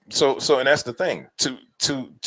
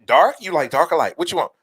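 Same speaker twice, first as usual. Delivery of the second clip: eager fawning questions, pitched up a little; smitten admirer; 6 semitones higher than usual. Acted. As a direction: play someone falling over themselves to please. Acts out eager toast-serving admirer.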